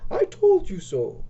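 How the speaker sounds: very high, slow prim lady voice; Victorian dame. Acted. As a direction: play a haughty Victorian dame. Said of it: "the voice of a Victorian dame".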